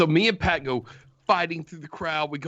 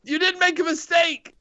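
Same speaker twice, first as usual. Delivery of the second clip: high, loud mock begging; mock pleading shout; 13 semitones higher, 4 dB louder than usual. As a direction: beg theatrically like a groveling fan. Begging online critic.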